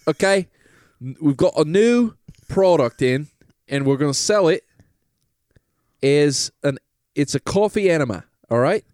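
hammed-up British pitch to the lads; British salesman. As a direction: sell it like a cheeky British huckster. Plays a British Goop salesman.

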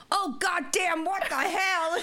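put-on high, loud startled old man's yelp; imitating old man. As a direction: squeal it like a shocked old passenger. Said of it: "And he goes".

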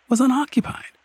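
ordinary delivery

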